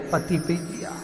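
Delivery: plain reading voice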